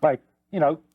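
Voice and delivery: ordinary talk, nothing marked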